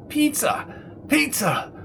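high, small squeal of a voice; crazed suspect. Acted. Rattled suspect dodging the policeman's question, crazed high voice. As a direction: squeak out each word in falsetto. Cornered Brownie's odd answer.

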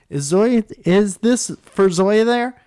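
high, loud, very slow nervous question; awkward caller. Retold or imitated. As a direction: stretch it out like an awkward teen on the phone. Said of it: "loser move".

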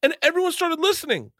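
high, laughing disbelief rant; exasperated laughing rant. Real, not acted.

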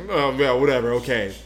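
mock dismissive brush-off; sassy smirk. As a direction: imitate a sassy, smirking instant reply. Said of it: "sassiness and fucking, like, smirk attitude".

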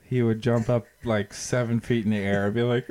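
ordinary talk, nothing marked

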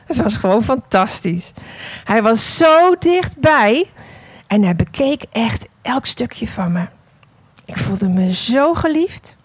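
unhurried storytelling voice, read as the clay vase; story narration. A narrator's reading. Preacher telling the congregation a parable, in storytelling voice.

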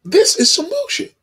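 high, crying mock outrage; fed-up angry wail. Woman fed up with men, high crying angry wail. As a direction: wail it like she's had enough. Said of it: "now she has the right to be mad and angry".

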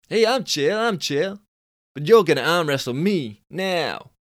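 high, loud Californian dude scoff. Acted. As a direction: squeak up the pitch like a cocky Californian. Billy voiced.

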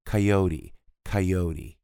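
coyote as kai-o-di, flapped T; coyote pulled to A. Shown as a demonstration. Teacher showing an American pronunciation. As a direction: pull coyote toward an A sound. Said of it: "puxando pro A".

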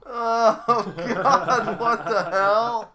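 high, loud laughing outburst; laughing shocked. Real, not acted. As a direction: laugh out your disbelief. Shocked reaction to the limerick.

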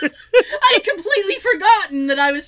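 high, loud laughing words. Real, not acted. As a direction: laugh through the line. Laughing while telling story.

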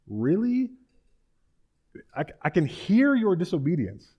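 high, rising mock disbelief; incredulous. Samuel catching Saul out, incredulous and high.

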